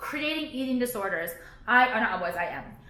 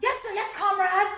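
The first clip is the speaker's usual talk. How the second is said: higher, sing-song yes-man imitation; mock obedience; 7 semitones higher, slower than usual. Mocking people who obey the government, higher sing-song. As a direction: parrot a meek obedient citizen. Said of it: "why is everyone like".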